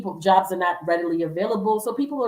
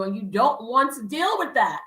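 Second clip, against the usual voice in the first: ordinary delivery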